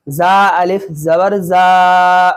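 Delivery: slow spelling-out, final "जा" held long; drawn-out vowel. Shown as a demonstration. Teacher explaining alif madda, drawn-out vowel. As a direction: stretch the aa of "जा". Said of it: "जा को खींच रहा हूँ".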